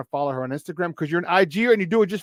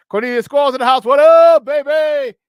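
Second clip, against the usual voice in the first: hype shout-out; 9 semitones higher, 12 dB louder than usual. Real, not acted.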